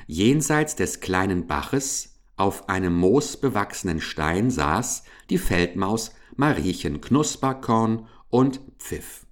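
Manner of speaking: plain reading voice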